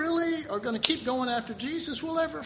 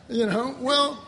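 ordinary delivery

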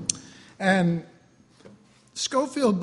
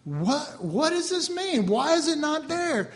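higher, puzzled mock complaint; mock confused reader; 6 semitones higher than usual. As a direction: act baffled at the missing part. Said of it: "that's where we go".

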